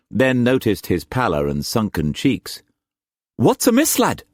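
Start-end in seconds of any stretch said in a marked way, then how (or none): 3.3-4.2 high-pitched, worried question; anxious. Acted. Uncle sees his nephew's pallor, anxious. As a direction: ask with urgent concern, pitch jumping up. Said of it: "he asked anxiously".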